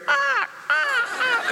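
very high cawing crow noise. Retold or imitated. Pastor mimics a crow instead of a rooster, high caws. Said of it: "instead of hearing cock-a-doodle-doo, you hear".

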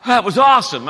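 mock kid delight. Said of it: "they'll go".

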